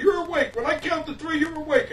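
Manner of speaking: high, loud, desperate hypnotist; imitated frantic commands. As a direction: order him awake in mock panic. Kid frantically trying to end hypnosis.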